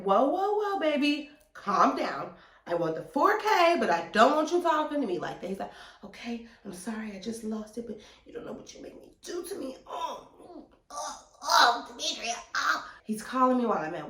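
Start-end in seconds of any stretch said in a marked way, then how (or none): 0.0-5.5 high, loud protest; firm pushback. Retold or imitated. Pushing back at his yelling. 6.0-9.8 soft, hushed, pleading apology; imitated apology. Man apologizing after screaming. 9.9-12.9 high, hammed-up lustful moans; mock moaning. Mimicking the man moaning on phone.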